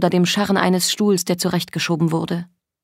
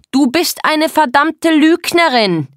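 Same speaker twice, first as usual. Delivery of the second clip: high, loud, drawn-out shout; furious accusation; 9 semitones higher, 5 dB louder, slower than usual. Acted. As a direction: hurl the accusation. Wütende Beschuldigung. (wütend geschrien)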